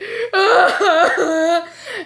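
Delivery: high-pitched boo-hoo wail; mock sobbing. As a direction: answer with exaggerated blubbering. Sobs to mom instead of answering.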